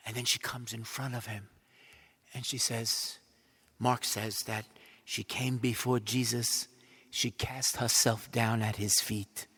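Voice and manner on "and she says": ordinary talk, nothing marked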